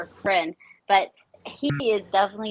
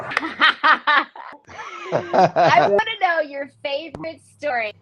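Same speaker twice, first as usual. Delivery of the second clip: laughing while talking; 5 semitones higher, 4 dB louder, slower than usual. Real, not acted. Laughing while asking the guest.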